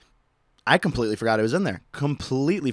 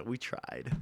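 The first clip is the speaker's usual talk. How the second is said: quiet shrug; 2 semitones lower, 14 dB quieter than usual. Retold or imitated. TSA shrug imitated.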